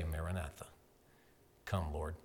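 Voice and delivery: soft Maranatha to end the sermon; hushed prayer. Shown as a demonstration. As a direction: breathe Maranatha like a closing prayer. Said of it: "And you can say".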